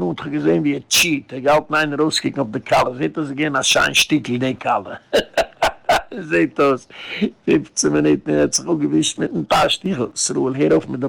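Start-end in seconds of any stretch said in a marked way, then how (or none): 5.1-6.2 short high chuckle; laughing. Real, not acted. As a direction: break briefly into a high laugh. Chuckles after own anecdote. (kurzes, hohes Lachen)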